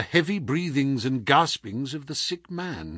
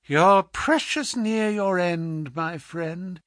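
menacing visitor; 4 semitones higher than usual. Acted. Visitor withholds death's release from patient, coldly gloating. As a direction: gloat over him, taking your time. Visitor threatens the sick man.

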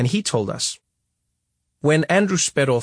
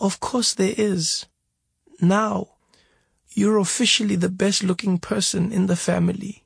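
teasing mother; 7 semitones higher than usual. A narrator's reading. Mother joking with her son from hospital bed. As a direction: deliver the joke gently.

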